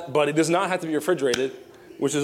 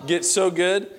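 ordinary talk

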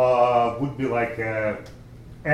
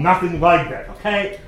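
usual speaking voice

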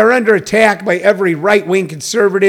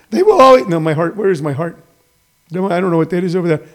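normal talking voice

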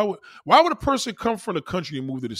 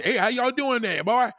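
high-pitched Texas twang; Texas country accent; 9 semitones higher than usual. Acted. Showing how Texans greet you, country accent. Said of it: "country people got country accents".

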